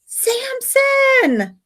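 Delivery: high, long falling call. Acted. Margot yelling for her fallen friend, high call. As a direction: yell his name down the hill. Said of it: "Margot's yelling".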